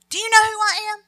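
hammed-up who-do-you-think-you-are; mock arrogance. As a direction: puff up like an offended big shot. Mock arrogant reply to devil.

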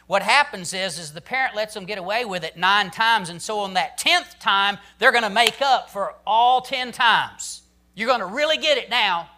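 preaching fervor. Acted. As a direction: drive the point home to the congregation. Preacher raising voice on point.

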